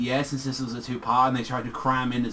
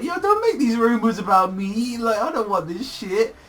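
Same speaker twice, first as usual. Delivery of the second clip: high-pitched mock protest; Koichi protesting; 7 semitones higher than usual. Koichi objecting to friends' rumors about him, high-pitched protest. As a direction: plead in a raised, put-on voice. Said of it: "Koichi's like".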